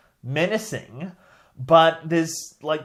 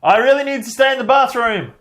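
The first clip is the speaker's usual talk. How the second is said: higher and louder, hammed-up urgency; mock excuse; 7 semitones higher, 7 dB louder than usual. Mocking a hero ducking out of the mission, hammed-up. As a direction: make the excuse big and desperate. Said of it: "I'm just gonna".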